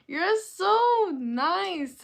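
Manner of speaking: loud, high, drawn-out gush; real, delighted gushing. Real, not acted. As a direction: gush sweetly, stretching the words out high. Gushing thanks to a complimenting viewer.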